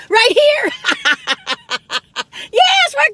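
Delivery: cheerful loud reply; excited answer. Pretend. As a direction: pop out the answer brightly. Answers her own song.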